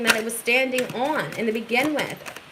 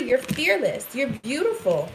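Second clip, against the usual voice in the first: usual speaking voice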